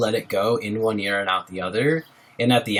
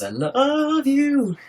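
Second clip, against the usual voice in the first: much higher, slow and stretched; drawn-out high sing-song thanks; sing-song love you; 13 semitones higher, much slower than usual. Real, not acted. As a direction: croon it to the chat. Thanking a viewer for bars.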